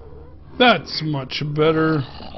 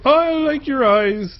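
sweet cooing to a cow; 9 semitones higher, 5 dB louder than usual. Real, not acted. Admiring the cow's eyes, cooing higher and slower. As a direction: coo at the cow like it's a cute pet.

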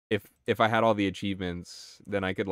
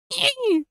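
very high, squealing laugh; squeal of laughter; 5 dB louder than usual. Real, not acted. Streamer cracking up at the dolphin clip. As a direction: burst out in a shrill laugh.

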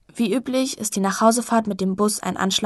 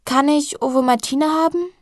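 put-on higher, loud child's plea; little girl asking; 6 semitones higher, 5 dB louder than usual. Jessie asks her sister for Ovomaltine, high child voice. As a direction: ask sweetly like a five-year-old.

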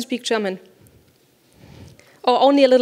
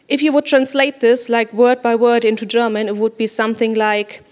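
ordinary talk

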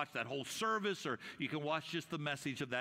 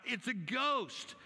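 mild frightened cry; 5 semitones higher, much slower than usual. A narrator's reading. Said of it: "they cried out".

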